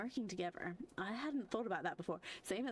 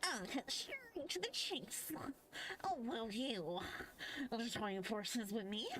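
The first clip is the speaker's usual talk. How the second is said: nerdy voice; 3 semitones higher, slower than usual. Acted. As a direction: play a squeaky nerd. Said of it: "I'm gonna give him the nerdy fucking voice now".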